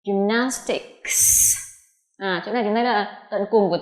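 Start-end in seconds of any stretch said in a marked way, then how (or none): 0.1-1.6 "Gymnastics" very slow, with a crisp k and s ending; ending sounds. Shown as a demonstration. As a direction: draw out "Gymnastics" to show its final sounds. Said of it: "chúng ta phát âm là âm k".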